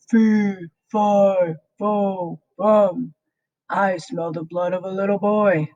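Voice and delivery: put-on greedy giant smells a boy, shouted chant; giant shouting. A narrator's reading. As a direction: chant it out like a hungry giant sniffing the air. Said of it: "the giant shouted".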